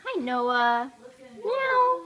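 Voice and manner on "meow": playful meow. Pretend. Imitating a cat to a cat.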